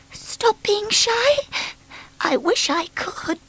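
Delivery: high, slow, hushed; wistful Miss Shy. Acted. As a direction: sigh it to herself.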